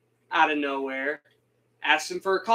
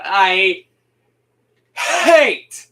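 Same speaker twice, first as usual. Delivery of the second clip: loud angry burst; 9 dB louder than usual. Real, not acted. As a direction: spit out hate with real fury. Venting hatred of Fabian.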